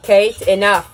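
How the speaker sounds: sharp, loud reprimand; stern command. Real, not acted. Mother putting a stop to fussing, stern and loud.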